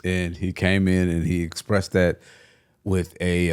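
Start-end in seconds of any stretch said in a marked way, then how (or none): none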